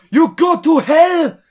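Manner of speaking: slow, loud high mock shout. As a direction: yell it, hammed up. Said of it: "she was like".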